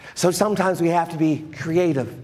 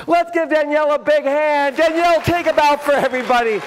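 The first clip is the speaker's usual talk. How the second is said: enthusiastic applause cue; 6 semitones higher, 3 dB louder than usual. Real, not acted. Cheering on the volunteer.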